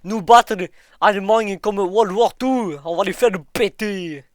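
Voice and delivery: boastful broken Russian general; Russian accent. Pretend. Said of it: "prends ton accent russe".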